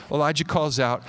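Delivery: normal talking voice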